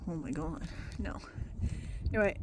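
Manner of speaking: ordinary delivery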